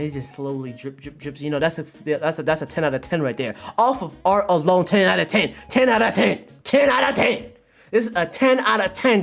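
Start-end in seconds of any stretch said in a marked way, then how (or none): 3.8-9.2 building higher and more insistent; emphatic hype. Real, not acted. Streamer rating the artwork for chat, building hype. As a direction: punch each repeat harder, rising in pitch.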